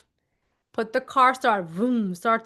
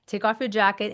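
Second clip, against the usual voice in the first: ordinary delivery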